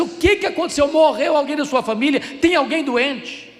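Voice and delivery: loud, high, urgent questions; alarmed shout. Retold or imitated. Pastor bursting into the crying seminarian's room, alarmed and loud. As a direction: burst in worried something terrible happened. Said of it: "entrei apressadamente".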